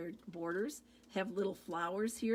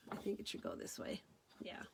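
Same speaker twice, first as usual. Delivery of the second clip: low whispered aside to self; 13 semitones lower, 8 dB quieter, faster than usual. Real, not acted. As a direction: mutter it under your breath. Unvoiced muttered aside.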